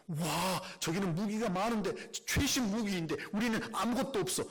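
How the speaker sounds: high, panicky gasp at the enemy's weapons; mock alarmed soldier; scared soldier. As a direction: play a soldier losing his nerve. Frightened soldier sees enemy weapons.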